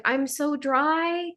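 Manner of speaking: comic whine of a dried-out droplet; whiny water drop. Acted. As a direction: play a pitiful thirsty drop. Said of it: "the drop of water is like".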